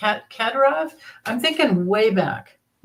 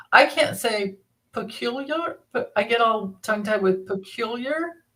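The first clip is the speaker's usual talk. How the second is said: ordinary delivery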